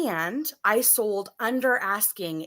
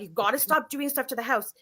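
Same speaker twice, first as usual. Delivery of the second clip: mild, higher-pitched telling-off; retold firm reprimand; scolding; 4 semitones higher than usual. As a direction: lay down the law to a child. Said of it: "I'm, like, scolding him".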